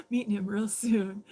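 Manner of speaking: laughing loudly through the words. Real, not acted. Laughs at her own sign.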